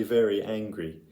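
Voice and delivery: ordinary delivery, nothing marked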